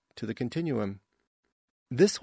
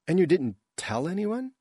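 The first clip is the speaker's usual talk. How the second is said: incredulous; 7 semitones higher than usual. Acted. Adel learns secret was kept.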